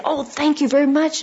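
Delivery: sudden delight. Retold or imitated. Student thanking her teacher, excited high-pitched thanks. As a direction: blurt thanks as it clicks.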